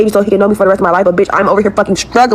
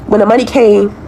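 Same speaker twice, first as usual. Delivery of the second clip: normal talking voice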